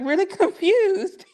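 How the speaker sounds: real laugh breaking into the word, high and bright. Real, not acted. Advice giver cracking up at her own example, real laughter. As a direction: laugh through it, then a breathy laugh alone.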